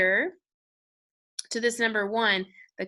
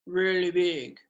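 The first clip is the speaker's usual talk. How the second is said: big low voice; 4 semitones lower, slower than usual. Shown as a demonstration. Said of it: "So say this with me".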